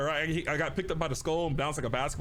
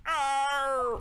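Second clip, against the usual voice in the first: mock jump scare; 9 semitones higher than usual. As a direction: jump out and startle.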